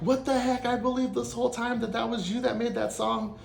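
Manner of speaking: high-pitched indignant mock yell. Yelling at the laughing friend who fooled him for years. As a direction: protest in high, outraged disbelief. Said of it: "I'm just yelling like".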